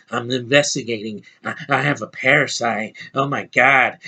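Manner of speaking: mocking Tom Hardy. Reviewer lampooning the British lead's movie performance, mocking impression. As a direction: send up a dopey, muttering film hero. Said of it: "neither are these freaking Brits".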